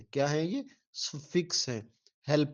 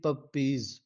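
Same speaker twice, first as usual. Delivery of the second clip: split into syllables; much slower than usual. Shown as a demonstration. Teacher to students, sounding out syllables slowly. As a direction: slowly sound out puppies in two beats, pa-piz. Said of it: "syllable".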